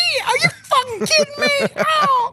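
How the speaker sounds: shrieking falsetto woman, outraged; man doing a woman's voice. Pretend. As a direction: shriek it high in mock outrage. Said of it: "I'll be the girl voice".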